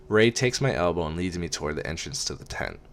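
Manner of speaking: ordinary delivery, nothing marked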